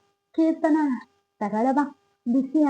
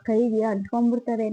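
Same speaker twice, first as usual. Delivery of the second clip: ordinary talk, nothing marked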